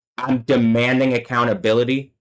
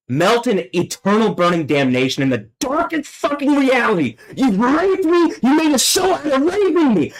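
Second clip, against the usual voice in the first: real fury; 12 semitones higher than usual. Real, not acted. Cursing his unseen tormentors, furious and much higher. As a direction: hurl the curse upward in raw anger. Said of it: "fuck, I'm angry".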